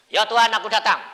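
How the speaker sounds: a full-voiced call to God; calling out to the Lord at full voice; loud cry to God. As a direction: throw the words up to heaven, loud. Preacher acts his prayer loudly.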